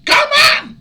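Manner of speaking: high, loud exasperated cry; outraged shout. Real, not acted. Ranting at people who eat strange animals, loud and outraged. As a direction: cry out in disbelief at the world. Outraged rant at foreign eaters.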